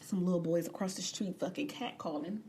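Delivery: ordinary talk, nothing marked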